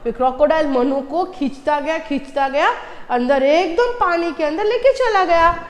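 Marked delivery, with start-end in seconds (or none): none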